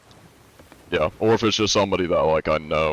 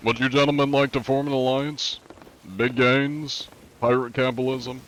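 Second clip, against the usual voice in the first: lightly put-on prim gentleman, a bit higher; polite gentleman pirate; 4 semitones higher than usual. Streamer hails a rival crew, lightly voiced courteous gentleman pirate. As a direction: sweet-talk the strangers like an overly courteous pirate.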